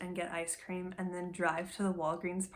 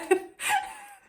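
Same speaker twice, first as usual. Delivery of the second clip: high laugh; 7 dB louder than usual. Real, not acted. Laughs with relief.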